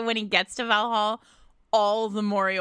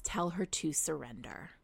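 breathy, unvoiced whisper of a warning; whispered message; 7 semitones lower, 12 dB quieter than usual. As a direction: whisper it like a secret prophecy. Prophecy quoted in retold scene.

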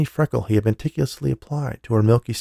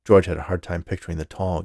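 ordinary delivery

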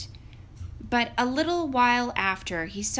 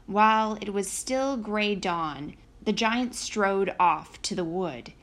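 ordinary delivery

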